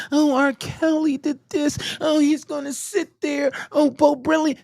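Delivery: high mock whine; high sarcastic voice of a flip-flopping critic; mocking complainer. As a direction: mimic a fickle fan mockingly. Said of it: "See, I'm not the one".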